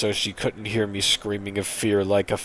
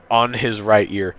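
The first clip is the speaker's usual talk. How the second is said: usual speaking voice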